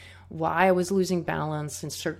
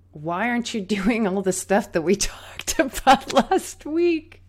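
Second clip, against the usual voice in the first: laughing; 5 semitones higher, 6 dB louder than usual. Retold or imitated. Teacher's scolding retold with laughter.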